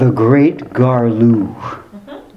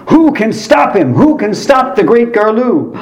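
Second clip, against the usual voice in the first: high-pitched, overwrought ad announcer's alarm; mock-grave concern, pitched way up with a gasp; hammed-up commercial panic; greatly concerned announcer; 9 semitones higher than usual. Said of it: "in a tone of great concern".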